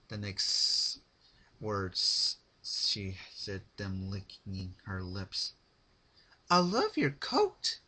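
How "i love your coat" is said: high, slow loud coo; creepy sweet voice. A narrator's reading. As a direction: coo it like a lunatic. Pinkie creepy affection.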